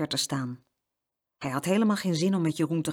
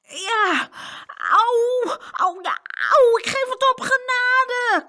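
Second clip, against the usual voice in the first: high, loud, hammed-up yelps of pain; groaning surrender; 7 dB louder, slower than usual. A narrator's reading. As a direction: beg for mercy, overdoing the agony. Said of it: "kreunde Peter".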